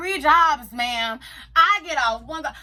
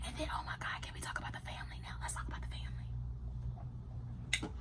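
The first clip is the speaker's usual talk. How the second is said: conspiratorial whisper, much softer; hushed gossip whisper to the viewers; 18 dB quieter than usual. Real, not acted. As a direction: lean in and whisper the juicy part.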